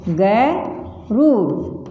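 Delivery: गरूर very slowly, long रू held; dictation demo. Shown as a demonstration. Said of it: "आप बोल के लिखो". (बोलकर लिखवाना, गरूर बहुत धीरे)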